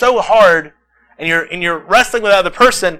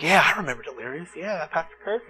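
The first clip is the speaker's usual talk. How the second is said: mock aside; 3 semitones lower, 11 dB quieter than usual. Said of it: "some of y'all are like".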